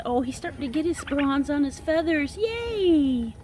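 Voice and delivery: high, fast, thrilled chatter; excited cooing; delighted squeal. Real, not acted. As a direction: gush over the bird's new colors. Proud of turkey's bronze feathers.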